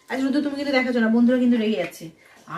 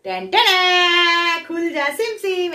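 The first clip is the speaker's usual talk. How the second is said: dramatic reveal; 6 semitones higher, 5 dB louder, much slower than usual. Acted. Surprise toy reveal fanfare.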